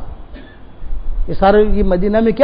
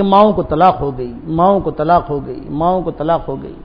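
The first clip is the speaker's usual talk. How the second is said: low, softer murmured news; hushed, spreading gossip; hushed rumour; 7 semitones lower, 6 dB quieter than usual. Retold or imitated. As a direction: pass the rumour along under the breath. Rumour whispered around Madina.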